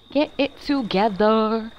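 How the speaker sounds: slow, laid-back bro voice; bruh voice. Shown as a demonstration. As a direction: say it like a chill frat bro. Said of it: "my bruh voice".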